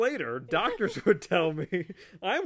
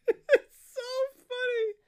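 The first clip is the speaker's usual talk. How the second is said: laughing. Real, not acted. Laughing hard.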